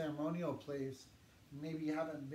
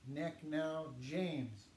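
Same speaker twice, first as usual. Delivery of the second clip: Nek now James, very slow and clear; teacher demonstrating, slowly; slow model phrase; much slower than usual. Shown as a demonstration. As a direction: spell it out slowly for the class. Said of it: "My name is".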